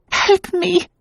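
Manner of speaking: panicked wife clinging to husband; terrified cry. Acted. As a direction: cry out half-awake in terror. Woman waking from nightmare.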